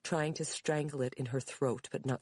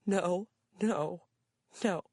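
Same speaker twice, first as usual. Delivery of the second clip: sobbing mother; 7 semitones higher, 3 dB louder, slower than usual. A narrator's reading.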